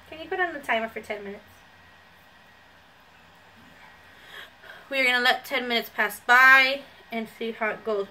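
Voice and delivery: wet sheet on lips; speaking through face mask. Real, not acted. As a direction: mask restricting mouth movement. Seen 1: sheet mask covers whole face, mouth opening, throughout speech.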